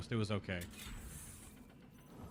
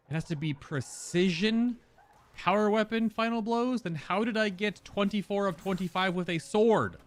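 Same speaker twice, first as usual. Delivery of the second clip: surprised; 8 semitones higher, 4 dB louder than usual. Real, not acted. Finds the quest needs another weapon.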